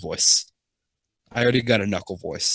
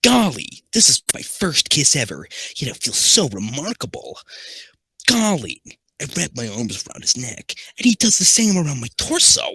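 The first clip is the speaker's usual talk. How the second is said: high, hammed-up gee-whiz hero; CDI Sonic; 6 semitones higher than usual. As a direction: gush in a high cartoon voice. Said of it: "first Sonic's gonna be C D I Sonic".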